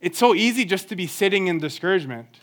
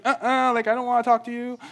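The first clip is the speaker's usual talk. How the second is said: put-on sassy toddler; 5 semitones higher, 4 dB quieter, faster than usual. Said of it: "so much attitude".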